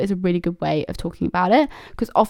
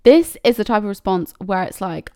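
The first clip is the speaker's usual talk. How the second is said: normal talking voice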